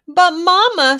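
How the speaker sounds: protesting cubs. A narrator's reading. Cubs protesting to Mama, loud and high. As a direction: blurt it out like an upset cub. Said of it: "spouted the Cubs".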